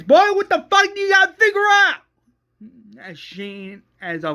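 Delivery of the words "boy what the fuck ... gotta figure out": very high, loud furious shout; screaming. Retold or imitated. Shane screaming at Rick, very high furious shout. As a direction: blast it at full volume. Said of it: "Shane screams".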